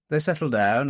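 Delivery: plain reading voice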